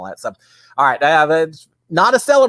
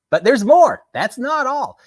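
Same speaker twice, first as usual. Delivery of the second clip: higher, louder hammed-up TV pitch; infomercial pitchman; 10 semitones higher, 5 dB louder, slower than usual. Telling viewers there's more, in a mock infomercial voice. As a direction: oversell it like a late-night ad host. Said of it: "just like they do in those infomercials".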